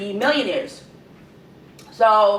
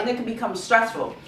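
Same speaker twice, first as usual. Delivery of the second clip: ordinary delivery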